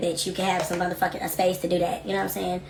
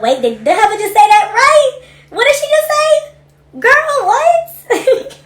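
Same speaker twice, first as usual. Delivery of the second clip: much higher, loud and incredulous; shocked disbelief; 13 semitones higher, 7 dB louder, slower than usual. Real, not acted. Host reacting loudly to a viewer's comment, shocked. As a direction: squeal it like you can't believe she said that.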